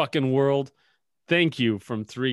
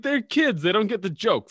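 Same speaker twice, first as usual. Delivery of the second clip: put-on pitched way up, loud, pleading that they're kids; high defensive protest; 9 semitones higher, 8 dB louder than usual. To his younger brother about explaining a joke, high whiny protest. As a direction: squeak out the excuse like a wounded little brother. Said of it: "I'm like".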